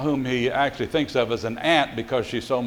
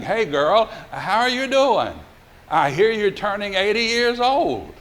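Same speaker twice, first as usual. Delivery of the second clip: playful high-pitched phone gush; gushing greeting; 7 semitones higher than usual. Retold or imitated. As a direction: greet an old pal with mock exuberance. Cousin greeting Lily on the phone.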